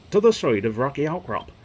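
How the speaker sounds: put-on northern English accent. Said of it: "Don't know why he went northern".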